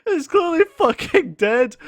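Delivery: high, loud cry-laugh of disbelief; crying with laughter at the sight. Retold or imitated. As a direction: squeal it through helpless laughter. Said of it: "I'm like".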